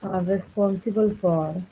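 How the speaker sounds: slow, measured read-out for note-takers; dictation. Real, not acted. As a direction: dictate word by word, leaving long gaps. Dictates notes while writing.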